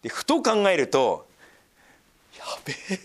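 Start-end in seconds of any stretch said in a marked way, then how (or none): 2.4-3.0 whispered やべえ, breathy and hushed; whispered panic. Retold or imitated. [name] realizing his mistake, a whispered oh no. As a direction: mutter やべえ under your breath in alarm. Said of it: "と思って".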